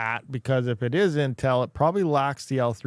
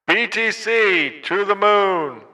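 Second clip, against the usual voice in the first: loud, higher hype through a megaphone; megaphone effect; 6 semitones higher, 7 dB louder, slower than usual. Shown as a demonstration. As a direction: blast it through a megaphone filter.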